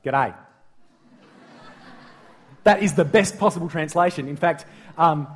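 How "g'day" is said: Aussie g'day. Shown as a demonstration. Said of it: "best translation in Australian English would be".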